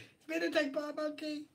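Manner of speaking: high laughing echo; amused, breaking up with laughter. Real, not acted. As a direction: repeat it through a laugh. Cracks up echoing the monkey line.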